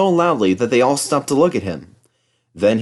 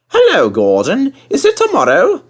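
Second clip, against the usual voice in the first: high, loud, cheeky teasing; 14 semitones higher, 6 dB louder than usual. A narrator's reading. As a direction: needle the proud engine. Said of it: "asked James".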